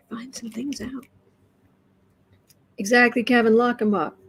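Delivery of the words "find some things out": breathy whispered aside. Real, not acted. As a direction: drop to a whisper, half to yourself.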